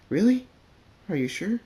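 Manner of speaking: high, loud disbelieving really; shocked surprise. Acted. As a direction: gasp it out in disbelief. Filly hears shocking news.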